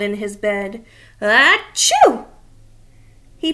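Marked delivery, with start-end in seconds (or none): 1.2-2.3 loud acted sneeze; big sneezy monster achoo; a hammed-up sneeze. Grover sneezes in bed.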